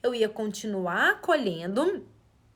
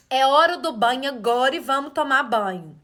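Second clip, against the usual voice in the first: louder, firm order; rigid command; 9 dB louder than usual. Shown as a demonstration. Example of a parent giving a no-choice bath order, loud. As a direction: bark the rule with no choice. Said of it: "Por exemplo".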